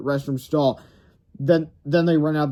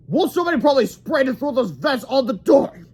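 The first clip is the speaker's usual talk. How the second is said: much higher gruff grown-up; principal voice; 10 semitones higher, 4 dB louder than usual. Acted. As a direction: explain it's shaving cream. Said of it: "Mister Crump said".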